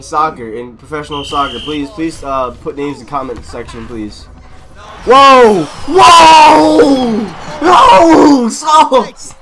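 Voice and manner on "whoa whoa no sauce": high, drawn-out hollers; wild excited yelling. Real, not acted. Gamer reacting to a wild play, wild excited yelling.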